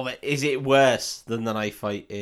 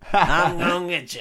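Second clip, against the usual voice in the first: mock threat; 5 semitones higher, 5 dB louder than usual. Mocking a bad knife fight.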